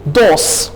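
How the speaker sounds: Dos with a soft dental d, not a hard retroflex D. Shown as a demonstration. Teacher shows the correct dental d in Dos. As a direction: Dos said dental, like Hindi दाल. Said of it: "pronounce करेंगे दाल से".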